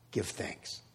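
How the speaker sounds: whispered, intimate. Acted. Pastor ending the sermon, whispered. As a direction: breathe the words out. Unvoiced closing line.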